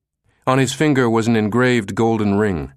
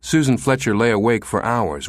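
usual speaking voice

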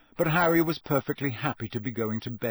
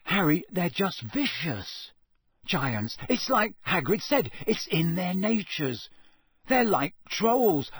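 higher, lightly voiced worried boy; uneasy Ron; 5 semitones higher than usual. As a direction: warn a friend, leaning on key words. Narrator voices Ron explaining giants.

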